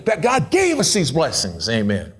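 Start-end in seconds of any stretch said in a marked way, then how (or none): none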